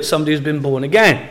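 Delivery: ordinary talk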